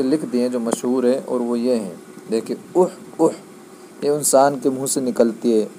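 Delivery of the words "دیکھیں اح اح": higher, put-on sick man's cough; mock cough for the class; demo cough. Shown as a demonstration. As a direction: cough it out like a patient. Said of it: "وہ کھانسی وغیرہ ہوتی ہے".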